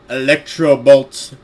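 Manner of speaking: loud, slow mock battle cry; drawn-out, over-dramatic shout; hammed-up attack call. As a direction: boom it out like a cartoon move. Mocks the attack name after laughing.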